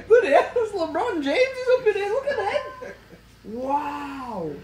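high, drawn-out delighted exclaiming; amazed excitement. Real, not acted. Marvelling at the newborn's size.

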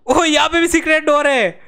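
high excited cry of discovery; amazed exclamation. Real, not acted. Gamer finds another secret door, amazed exclamation. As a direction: exclaim it in amazement, falling at the end.

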